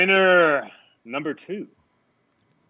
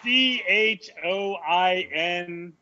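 slow spelling; 3 semitones higher, much slower than usual. Shown as a demonstration. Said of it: "spelling the name out".

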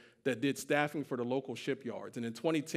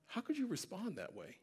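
very soft, raised-pitch question; hushed disbelief; 5 semitones higher, 10 dB quieter than usual. Retold or imitated. Staring coworkers asking about his response, hushed disbelief. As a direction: imitate an astonished near-whispered question. Stunned coworkers ask him.

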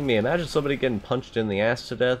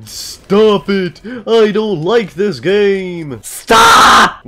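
shouting; 8 semitones higher, 10 dB louder, much slower than usual. Real, not acted. Yelling at game.

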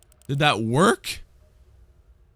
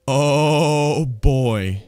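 normal talking voice

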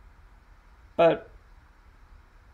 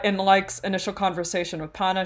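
ordinary talk, nothing marked

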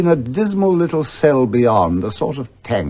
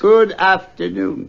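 slow, raised and insistent; firm dismissal; 7 semitones higher than usual. Acted. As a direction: shut the door on the talk. Scrooge repeats dismissal, firmer.

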